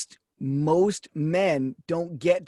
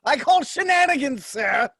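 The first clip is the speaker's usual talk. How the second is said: higher, hammed-up old-timey gentleman; mock old-fashioned accusation; 7 semitones higher than usual. [name] accuses Pat in a hammed-up old-timey voice. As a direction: play a pompous gentleman crying foul.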